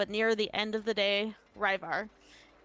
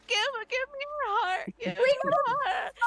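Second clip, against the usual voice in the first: high-pitched blubbering plea; mock crying. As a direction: wail it like a hammed-up crybaby. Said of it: "he starts crying too".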